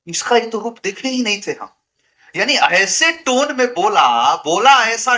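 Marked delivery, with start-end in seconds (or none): 0.1-1.7 shocked family. Retold or imitated. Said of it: "सब लोग दंग रह गए".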